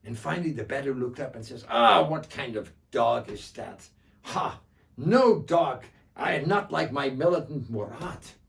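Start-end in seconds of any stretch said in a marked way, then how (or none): 1.7-8.3 scornful. Acted. Bedou scorns the mangy dog.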